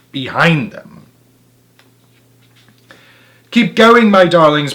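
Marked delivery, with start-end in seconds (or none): 3.5-4.7 higher, louder urgent call; urging pant. A narrator's reading. Mister Fox urges his family on, higher and louder. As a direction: drive them forward while running. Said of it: "panted Mister Fox".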